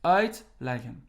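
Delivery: "uitleggen" with the stress on UIT; stress on first part. Shown as a demonstration. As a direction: punch UIT, let "leggen" drop away in "uitleggen". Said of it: "stress will be on the beginning".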